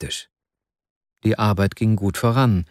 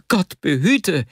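alarmed outcry; 8 semitones higher, slower than usual. A narrator's reading. Wasja rejects his friend's plan, crying out in alarm. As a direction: cry out in dread, pitch leaping up. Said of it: "schrie Wasja auf".

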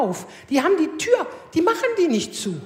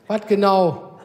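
low gruff mock of the husband; lightly voiced sulky husband; lower mock husband; 8 semitones lower, slower than usual.

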